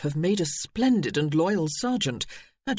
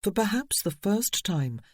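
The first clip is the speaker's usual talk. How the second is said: ordinary delivery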